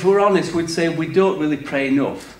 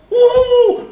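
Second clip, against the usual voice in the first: high, loud whoop; excited whoop; 7 dB louder than usual. Retold or imitated. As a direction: mimic a crowd whooping wildly. Mimicking excitement at devil-binding prayers.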